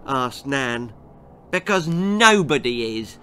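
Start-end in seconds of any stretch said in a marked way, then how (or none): 1.5-3.2 high, loud gloating taunt; cruel girl. A narrator's reading. Girl telling Nan nobody is coming, loud gloating. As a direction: gloat over the empty guest list.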